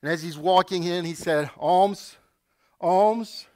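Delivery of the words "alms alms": louder, pleading alms; a lame beggar calling out; begging call. Acted. Lame man begging at temple, louder.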